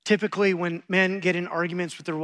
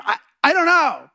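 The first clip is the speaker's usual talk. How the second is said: high, loud helpless wail; cornered husband blurting he has no idea; panicked give-up; 8 semitones higher, 7 dB louder than usual. Retold or imitated. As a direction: throw up your hands in defeat.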